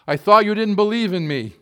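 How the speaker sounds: lightly voiced God calling down from above; booming voice. God calling down to the atheist, a hint of booming. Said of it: "a booming voice came down from the clouds".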